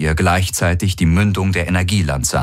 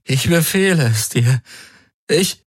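high, strained, desperate order; strained command; 10 semitones higher than usual. A narrator's reading. Man in agony trying to command the Helix. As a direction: force a command through pain.

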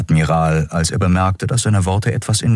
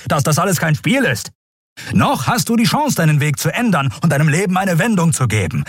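high-pitched, forceful lecture; admonish, urgent and emphatic; stern admiral; 8 semitones higher than usual. Acted. (strenger Admiral, eindringlich mahnend)

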